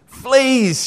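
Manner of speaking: sudden high alarmed cry; cried out. A narrator's reading. Corrie crying out to her sister about fleas, alarmed. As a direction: yelp it out in shock. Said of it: "she cried".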